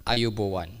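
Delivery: careful learner's Ayubowan, each syllable placed; practiced Sinhala greeting. Shown as a demonstration. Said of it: "I was practicing this".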